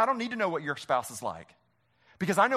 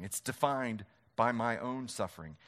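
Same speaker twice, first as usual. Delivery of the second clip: usual speaking voice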